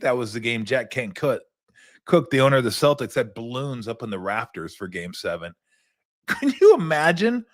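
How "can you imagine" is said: laughing disbelief. Real, not acted. Laughing at balloon story.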